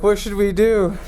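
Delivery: high, loud, frightened; scared kids' voice. Acted. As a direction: panic as a kid. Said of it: "they're like".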